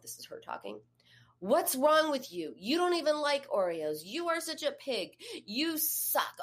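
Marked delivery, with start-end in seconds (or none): 1.4-6.4 high-pitched, berating self-talk; acting out a woman's mean self-criticism; mean inner voice. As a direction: scold yourself harshly in a raised pitch. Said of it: "talking in a mean voice to yourself".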